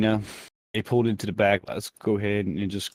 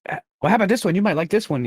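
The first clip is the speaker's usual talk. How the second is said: toymaker voice; 7 semitones higher, 3 dB louder, much faster than usual. Acted. GM voicing toymaker selling.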